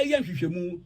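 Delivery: ordinary talk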